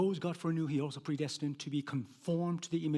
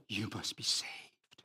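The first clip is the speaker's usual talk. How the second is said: hushed urgent whisper; breathy whispered urging; 6 semitones lower, 7 dB quieter, slower than usual. Retold or imitated. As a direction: whisper it like a secret plea. Preacher quoting his evangelism.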